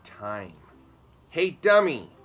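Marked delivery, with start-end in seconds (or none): 1.3-2.1 loud, higher playground jeer; taunting call. A narrator's reading. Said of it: "a boy called out".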